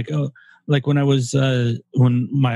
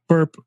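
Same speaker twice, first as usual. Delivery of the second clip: burp; 6 semitones higher than usual. Real, not acted. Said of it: "Excuse me".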